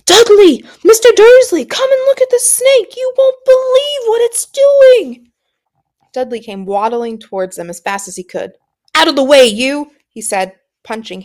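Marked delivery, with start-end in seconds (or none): 0.0-5.3 very loud, much higher excited yelling; deafening shout. Acted. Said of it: "a deafening shout behind Harry". 8.9-9.9 louder, higher bark of a bully; rough shove-off. Acted. Dudley shoves Harry aside.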